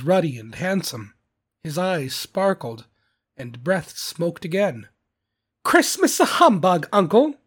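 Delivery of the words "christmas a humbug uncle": put-on high, loud, incredulous young relative; Scrooge's nephew. A narrator's reading. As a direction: protest to your uncle in a raised voice. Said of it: "said Scrooge's nephew".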